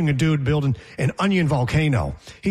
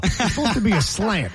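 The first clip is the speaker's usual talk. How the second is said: ordinary talk, nothing marked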